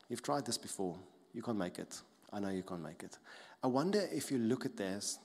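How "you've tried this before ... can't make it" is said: soft, falling, defeated put-down; voice of shame. Acted. Shame from past failures speaking to you, low and discouraging. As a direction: quietly weigh them down with past failures. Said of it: "then the shame from the past".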